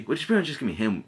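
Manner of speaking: usual speaking voice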